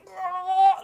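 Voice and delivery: high strangled cry, imitating a trapped scream; muffled scream. Retelling a nightmare to friends, a high strained cry. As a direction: reenact a scream that won't come out. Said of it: "you could hear me trying to fucking scream".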